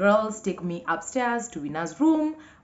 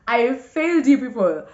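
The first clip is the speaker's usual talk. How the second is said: louder, higher mock lament; 7 semitones higher, 8 dB louder than usual. Real, not acted. Vlogger confessing to her viewers, louder mock lament. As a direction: raise the pitch and volume on I failed you people. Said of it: "Guys, I did not see it coming".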